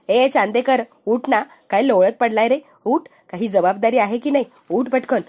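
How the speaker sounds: ordinary delivery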